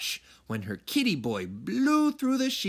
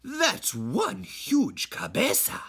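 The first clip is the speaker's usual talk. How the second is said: amazed kitten; 7 semitones higher than usual. Acted. Kitten marveling at big head.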